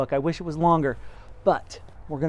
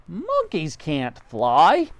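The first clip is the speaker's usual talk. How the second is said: very slow, spelled-out scoff; monkey scoffing at flying; 4 semitones higher, 5 dB louder, much slower than usual. Acted. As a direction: drag it out scornfully.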